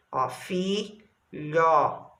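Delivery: filhotinho slowly split, fi... lho... ti... nho; long pause after each syllable of filhotinho. Shown as a demonstration. Teacher breaking up a word for pupils, syllable by syllable. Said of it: "temos uma sílaba fi".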